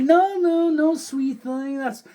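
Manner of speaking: high, slow Texas drawl; Southern clerk. Retold or imitated. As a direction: coo it like a sweet Southern clerk. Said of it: "she's like".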